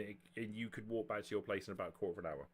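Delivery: ordinary delivery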